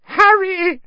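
very high, loud squeal; shrieked squeaky gasp. A narrator's reading. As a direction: gasp the name out, overwhelmed. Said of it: "gasped Pettigrew".

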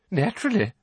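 put-on a touch of smug old witch. Portrait witch answers Dumbledore, lightly voiced old woman.

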